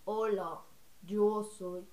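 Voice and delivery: low put-on voice; Daniela. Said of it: "usar la voz de Daniela así".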